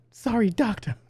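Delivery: squeaky-high apology; put-on high little voice; high-pitched character voice. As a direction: imitate her with a very high voice. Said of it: "just in a really high-pitched voice".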